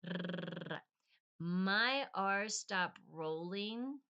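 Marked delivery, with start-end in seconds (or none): 0.1-0.8 R as a long rattling trill; rolled R. Shown as a demonstration. Giving learners a sound to imitate, R as a long trill. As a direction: trill R on the tongue tip. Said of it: "just try to imitate it".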